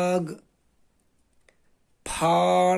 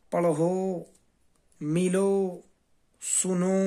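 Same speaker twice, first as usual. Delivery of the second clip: ordinary delivery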